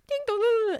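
high sung ding dong; doorbell chime. Retold or imitated. Janitor lady mimicking a bell, high sung. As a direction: sing it like a chime. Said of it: "she's like".

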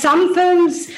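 normal talking voice

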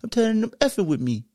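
lightly imitated frustrated outburst, high-pitched; Trey. Retelling a movie character crying and punching the air, mock frustrated. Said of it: "'cause he was so frustrated".

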